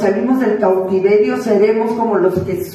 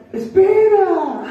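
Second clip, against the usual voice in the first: slow, stretched, higher call; drawn out; 9 semitones higher, much slower than usual. Real, not acted. Preacher stops the congregation, drawn out. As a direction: hold the word long.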